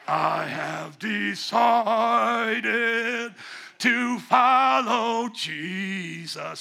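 slow booming wrestler voice, drawn out; wrestling promo. Pretend. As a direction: belt it like a WWF wrestler. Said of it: "crazy W W F wrestler voice".

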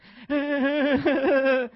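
loud, high mock panicked wail; drawn-out high whimper of hammed-up panic; imitated freaked-out translator. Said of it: "he's freaking out".

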